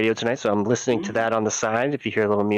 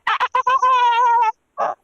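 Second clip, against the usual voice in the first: high, loud, drawn-out wailing ah sounds; 5 dB louder than usual. Retold or imitated. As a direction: go full belt, wail it high and slow. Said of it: "I'm working on my [name] impression".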